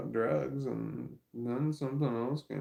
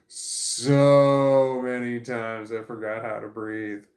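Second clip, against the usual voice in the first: slow loud emphasis; 10 dB louder, slower than usual. Real, not acted. Stoned vlogger, exaggerated slow emphasis.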